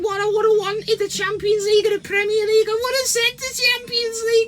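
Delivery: mocking Scouse fan. Mocking a Liverpool fan's claim in a high whiny voice. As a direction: sneer it out as a whiny rival fan. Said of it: "they'll all say".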